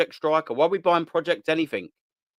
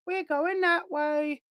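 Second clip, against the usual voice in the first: much higher, slow and soft; mock high drawn-out; 12 semitones higher, 4 dB quieter, much slower than usual. Mocking the club's long wait, high and slow. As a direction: stretch it up high in mockery.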